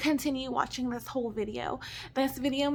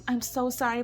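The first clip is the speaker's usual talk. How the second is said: ordinary delivery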